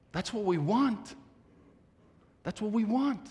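rising preaching call. Real, not acted. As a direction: lift it up to the rafters. Preacher building to a peak.

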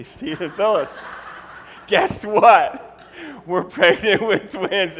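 loud, slow giggly news; laughing announcement. Retold or imitated. Couple telling friends real twin news, laughing. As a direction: blurt it out laughing. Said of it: "we say".

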